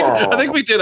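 normal talking voice

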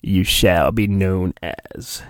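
low, slow sinister proclamation; Sidious voice. Acted. Reading the book aloud, doing the Emperor naming his apprentice. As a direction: drop the voice and intone the new name slowly. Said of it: "ready? clears throat".

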